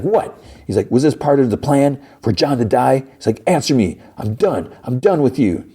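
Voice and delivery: high, furious questioning; angry demand. Acted. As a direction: demand answers, then cut them off. Parker confronts the whispering voices.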